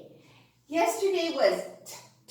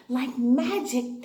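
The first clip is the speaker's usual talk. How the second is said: ordinary talk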